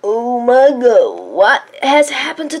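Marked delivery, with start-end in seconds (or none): none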